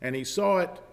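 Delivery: high, loud, fervent preaching; preaching at full force. Acted. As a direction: drive the scripture home. Preaches John 8 dispute loudly.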